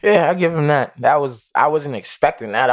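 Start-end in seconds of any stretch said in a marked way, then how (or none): none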